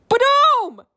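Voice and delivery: booming high blast sound; loud explosion boom. Acted. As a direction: belt out a big explosion boom.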